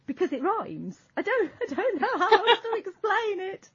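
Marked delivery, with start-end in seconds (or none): 1.2-3.7 high-pitched, helpless laugh through the words; laughing exasperation. Real, not acted. Podcaster failing to explain slang to co-host, laughing exasperation. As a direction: give up explaining, voice climbing as you laugh.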